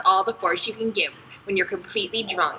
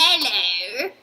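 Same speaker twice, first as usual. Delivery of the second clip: hammed-up Australian greeting, rising swoop; exaggerated mock-Australian twang; faux Australian accent; 3 semitones higher, 3 dB louder than usual. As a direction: overdo a cheery Australian accent. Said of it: "Fox Australian accent".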